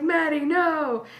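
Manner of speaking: mock scolding. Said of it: "And I'm like".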